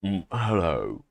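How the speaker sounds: put-on tentative try at a British accent. Host testing a half-forgotten British announcer voice, mock British accent. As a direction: attempt a British hello, unsure of it. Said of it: "how do you even do a British accent".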